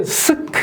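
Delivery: high, clipped-off "스크" as bare sk; correct consonant cluster. Shown as a demonstration. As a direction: say "스크" with s and k together. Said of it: "이렇게 해야 되는데".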